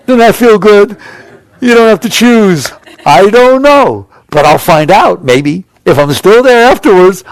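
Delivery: giddy, lifted-up not-knowing; bright elated voice. Shown as a demonstration. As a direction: sell the freedom with high delight. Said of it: "why would I wanna talk like that?".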